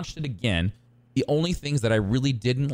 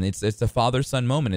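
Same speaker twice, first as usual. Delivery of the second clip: ordinary talk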